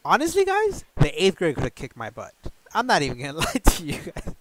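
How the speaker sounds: high, loud laughing aside; laughing at how small he was then. Real, not acted. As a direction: laugh it off at yourself. Laughing at his own bravado.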